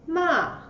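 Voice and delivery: "Ma" starting high and falling sharply; fourth tone. Shown as a demonstration. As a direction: say "Ma" in Mandarin fourth tone, dropping down. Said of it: "The tone goes down".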